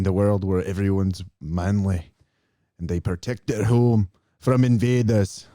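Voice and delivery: shaky grasp of a Scottish accent, proudly put on; bad Scottish accent. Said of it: "I'm not doing a very good Scottish accent".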